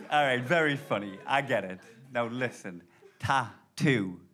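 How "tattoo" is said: ordinary talk, nothing marked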